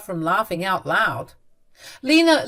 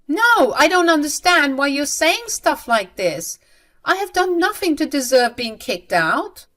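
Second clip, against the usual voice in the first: high, loud protest of innocence; acted indignant mother; indignant disbelief; 8 semitones higher, 5 dB louder than usual. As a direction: protest the unfairness, bewildered. Mother protests being kicked out.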